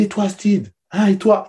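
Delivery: high, loud call-out to a player; coach's challenge. Retold or imitated. Coach in the locker room pushing Steve to shout, loud and high. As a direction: press him hard, like a coach daring him.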